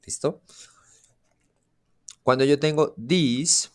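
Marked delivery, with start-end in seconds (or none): 2.9-3.7 this, said the English way, slowly. Shown as a demonstration. Teacher showing students the English word, clear and slow. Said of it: "this con I le llamo yo".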